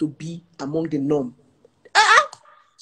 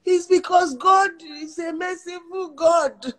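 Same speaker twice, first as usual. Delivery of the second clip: high laughing; 11 semitones higher, slower than usual. Real, not acted. Laughing at own joke.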